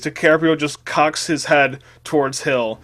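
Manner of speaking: plain reading voice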